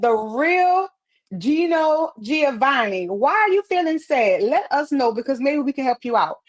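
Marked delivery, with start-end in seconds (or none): none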